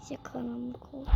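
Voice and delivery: hushed whisper in the dark. Real, not acted. Dark game room, scary.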